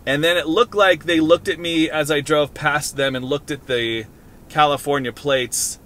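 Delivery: normal talking voice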